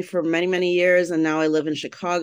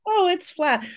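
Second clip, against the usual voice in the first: high mock chirp, pleased with herself; playful high-pitched self-quote; 12 semitones higher than usual. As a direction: squeak it out like admiring a flat stomach. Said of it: "kind of be like".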